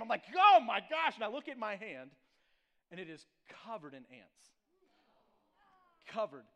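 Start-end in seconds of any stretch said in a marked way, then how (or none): 0.4-1.1 much louder and higher sudden cry; shocked yelp. Retold or imitated. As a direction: blurt it as the pain hits. Sudden sting of ants.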